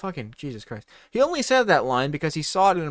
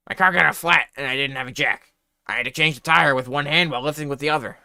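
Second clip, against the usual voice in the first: excuse voice; 5 dB louder than usual. A narrator's reading. Student's tall excuse.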